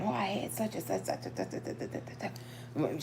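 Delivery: hushed gossip trailing into a whisper. Retold or imitated. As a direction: lean in and whisper like spreading gossip. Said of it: "people ain't whispering".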